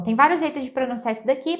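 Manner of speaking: usual speaking voice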